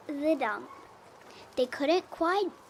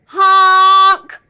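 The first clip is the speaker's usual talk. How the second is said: big loud honking blast; yelled honk; 6 semitones higher, 19 dB louder than usual. A narrator's reading. Dump truck pushes past the animals, loud yelled honk. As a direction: blare it like a big truck horn. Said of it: "yelled the dump truck".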